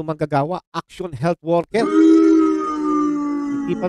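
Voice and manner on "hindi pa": mock drawl. Mock dramatic drawl over list.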